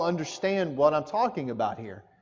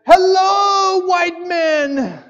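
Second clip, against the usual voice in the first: high, loud shouted greeting; excited child yelling hello; child's yell; 13 semitones higher, 9 dB louder, much slower than usual. Retold or imitated. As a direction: call it out like a boy across the road. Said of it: "yelled at us and said".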